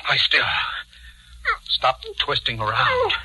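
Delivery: pin her down with the voice; husband orders struggling wife to lie still; forceful commands. Acted.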